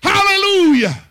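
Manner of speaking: high, loud hallelujah shout; preaching at full force. Acted. Preacher rousing the congregation, high and loud. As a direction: lift the crowd up.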